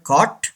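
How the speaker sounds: COAT said as "caught", open "aw"; wrong pronunciation. Shown as a demonstration. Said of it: "दोनों गलत हैं". (caught यानी "कॉट", गलत उच्चारण)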